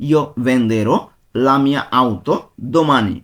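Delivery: slow, careful full sentence in Italian; slow demonstration. Shown as a demonstration. Teacher giving students the full sentence, slow and clear. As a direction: lay the whole sentence out slowly. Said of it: "ভেঙে ভেঙে বলছি যেন আপনারা সহজে বুঝতে পারেন".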